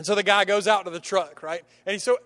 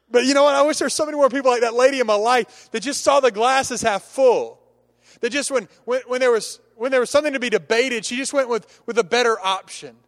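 louder, higher, driving the point home; full-force preaching; 4 semitones higher, 4 dB louder than usual. Acted. Preacher rousing the church to keep going, full force. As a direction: preach it out to the congregation.

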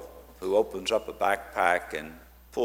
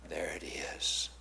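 whispered softly in awe; hushed whisper; 8 semitones lower, 9 dB quieter, slower than usual. Retold or imitated. Telling the congregation about the girl's gift, hushed whisper. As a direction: breathe it out like a secret wonder.

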